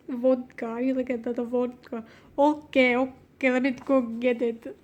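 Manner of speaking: hammed-up Russian accent; badly faked Russian, heavy on the vowels; mock Russian accent. Shown as a demonstration. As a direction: put on a cartoon Russian accent. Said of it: "do it like Russian".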